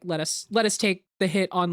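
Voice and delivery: normal talking voice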